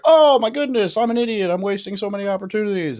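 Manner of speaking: loud, high exclamation of sudden realization; retold epiphany. Podcast guest retelling his reaction to a finance book, retold epiphany. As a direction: burst out high and loud, easing slightly toward the end.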